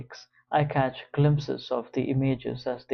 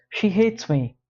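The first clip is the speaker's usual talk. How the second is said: higher, raised angry snarl; 8 semitones higher, 5 dB louder than usual. Acted. George blaming the sister, snarling and raised. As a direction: spit it out with contempt. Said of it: "George snarls".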